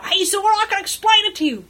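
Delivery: high, loud protest while being hauled off; Beaver protesting. Acted. Beaver dragged away by guards, loud high protest. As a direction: plead your case loudly in a high character voice. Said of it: "getting dragged away by some".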